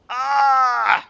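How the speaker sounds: loud, high scream of real frustration; frustrated scream. Real, not acted. Streamer screams in frustration after another setback. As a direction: let out a raw yell of exasperation.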